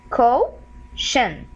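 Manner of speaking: "co-shin" split, "co" rising, then falling "shin"; "co-shin" sounded out with a gap; syllable-by-syllable demo. Shown as a demonstration. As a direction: break "co-shin" into two slow halves. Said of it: "So here we have".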